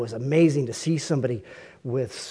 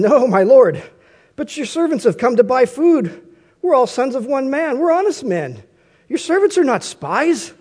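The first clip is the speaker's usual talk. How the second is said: higher-pitched pleading, acted out in a reading; horrified protest; 9 semitones higher, 5 dB louder than usual. A narrator's reading. Brothers denying to Joseph they are spies, horrified protest. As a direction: read it as an earnest, raised-pitch denial. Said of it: "the brothers are horrified".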